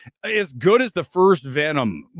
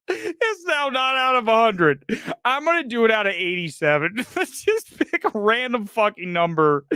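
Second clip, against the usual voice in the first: normal talking voice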